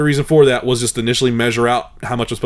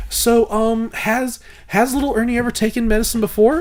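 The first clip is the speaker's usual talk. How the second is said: higher-pitched, earnest pharmacist imitation; retold deadpan inquiry in a raised pitch; dead serious; 8 semitones higher than usual. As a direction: mimic her careful, serious question. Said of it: "she looks me dead in the face and all just seriousness".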